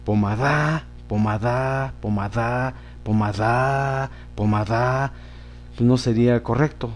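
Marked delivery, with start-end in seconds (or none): 0.0-5.1 pomada said as po-ma-DÁ, last syllable stressed; teacher showing the wrong final stress po-ma-DÁ. Shown as a demonstration. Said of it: "Si la llevaran la da".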